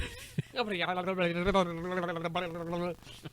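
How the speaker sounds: high, rapid-fire auctioneer babble; auctioneer gibberish. Acted. Speaker imagining Josh telling the joke, auctioneer babble. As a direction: rattle off wordless auctioneer patter. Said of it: "Doing it as an auctioneer".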